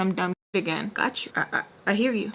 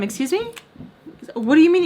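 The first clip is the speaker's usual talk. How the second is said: indignant excuse me; 8 semitones higher, faster than usual. Retold or imitated. Retold sharp comeback.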